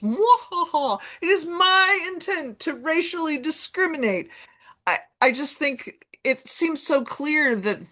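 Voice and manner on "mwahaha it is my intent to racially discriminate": high evil villain voice with cackle; Bond villain. Retold or imitated. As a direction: gloat like a cartoon supervillain. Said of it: "the map makers have to be like Bond villains".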